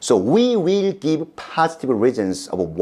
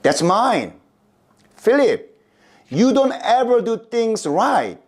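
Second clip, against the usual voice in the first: high-pitched scolding, rising sharply; indignant children; 11 semitones higher than usual. Acted. Children scolding Philip over empty container.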